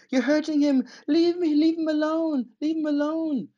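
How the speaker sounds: high, loud desperate plea; imitated scream. Retelling Ada screaming at the police, high and loud. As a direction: shriek at them to leave him alone. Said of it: "Ada's screaming".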